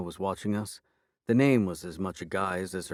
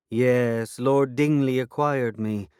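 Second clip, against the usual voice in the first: defiant fairy, slow and raised; 4 semitones higher, 7 dB louder, slower than usual. A narrator's reading. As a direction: answer back defiantly. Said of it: "she replied defiantly".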